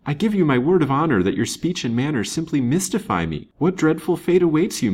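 high, emphatic bewilderment; earnest confusion, pitched well up; mystified protest. A narrator's reading. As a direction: insist that you are baffled. Mystified man protests and questions her.

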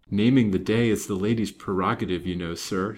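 ordinary delivery

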